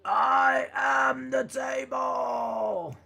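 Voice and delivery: high, very slow hammed-up shout; mock wrestler shout. Streamer voicing a wrestler after the table didn't break, mock shout. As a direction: stretch it out like a wrestler claiming the ring.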